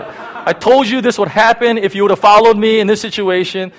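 loud, high hammed-up telling-off; mock angry scolding. GPS berating a driver who ignored it, mock angry scolding. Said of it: "the G P S doesn't come back and say".

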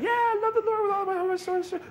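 high, fast, glib mock shout; mock churchgoer answer. Churchgoer brushing off the command, high mock shout. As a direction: toss off the familiar words like a bored churchgoer. Mocking churchgoers' rote reply.

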